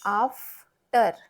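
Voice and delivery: "After" slowly split as आफ, then टर; broken into syllables. Shown as a demonstration. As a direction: sound out "after" with a gap before टर. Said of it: "अ भी होता है और आ भी".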